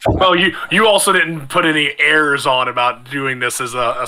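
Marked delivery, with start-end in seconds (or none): none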